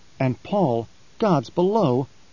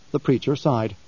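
ordinary delivery

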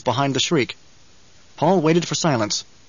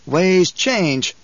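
a touch higher, dry reply; 4 semitones higher than usual. A narrator's reading. Paul probing the crowd's emotional undercurrents, dry. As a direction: answer dryly, probing their mood. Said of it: "Paul spoke dryly".

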